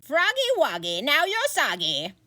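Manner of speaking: hammed-up wizard curse rhyme; spell rhyme. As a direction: chant the curse like a nursery rhyme.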